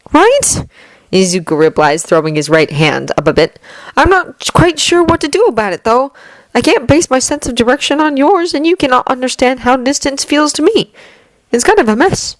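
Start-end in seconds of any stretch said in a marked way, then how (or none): 0.1-0.7 put-on higher, earnest young man's voice; young hero voice. Izuku replies eagerly. 4.0-12.4 put-on higher, earnest young man's voice; young hero voice. Izuku voiced.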